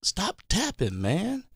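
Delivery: high, soft exasperated plea; mock pleading. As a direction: beg the tap dancer to quit. Acting out plea to comic.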